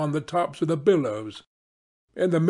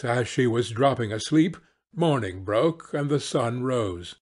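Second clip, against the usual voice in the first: usual speaking voice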